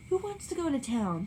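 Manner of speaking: Daisy presses the company to leave; insistent demand. A narrator's reading. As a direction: push the question, insisting. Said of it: "demanded Daisy insistently".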